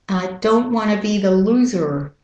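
American R at the end of "loser"; American-accent model sentence. Shown as a demonstration. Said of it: "You hear the er".

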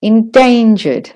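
"endangered" with DANE slightly longer; stress on DAN. Shown as a demonstration. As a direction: lean on the middle syllable of "endangered". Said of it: "slightly louder and slightly longer".

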